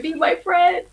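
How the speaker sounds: pleading pet dog. Acted. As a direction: play a dog wanting a friend. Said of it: "and Bear going".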